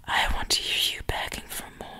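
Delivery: unvoiced whisper, close and teasing. Mistress whispering to her pet what she wants to hear. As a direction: lean in and whisper the demand.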